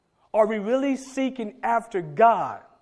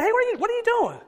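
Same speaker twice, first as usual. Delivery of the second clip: much higher, loud playful father asking; mock high dad voice; 4 dB louder, much faster than usual. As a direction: play the surprised dad, pitched way up. Pastor voicing himself to kids.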